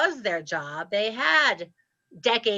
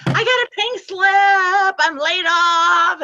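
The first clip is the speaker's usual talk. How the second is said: much higher, louder, slow, delighted news; joyful announcement; 8 semitones higher, 7 dB louder, slower than usual. Retold or imitated. Telling her dad she got laid off, overjoyed. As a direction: sing out the layoff like a celebration.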